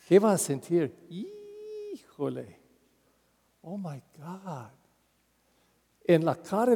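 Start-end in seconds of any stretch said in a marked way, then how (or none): 1.1-4.7 stunned awe. Acted. Listener stunned by Jesus' forgiveness.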